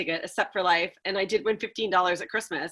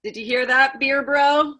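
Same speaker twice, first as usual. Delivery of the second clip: calling out; 6 semitones higher, 6 dB louder, slower than usual. Acted. Calling out to absent beer brewer.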